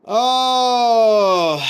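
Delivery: long high loud drawn-out oh; anguished cry. Real, not acted. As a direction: let out a pained wail. Despair after lost fight.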